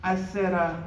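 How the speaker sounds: ordinary talk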